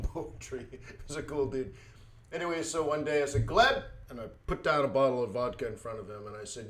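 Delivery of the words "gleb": high, loud retold call; mock summons to a friend. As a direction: call his name sharply to get his attention.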